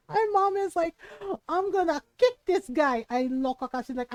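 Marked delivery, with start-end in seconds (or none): 1.5-3.8 high, loud mock-furious mother; imitating mad mom. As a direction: threaten like an outraged mother, hammed up. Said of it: "She was so mad".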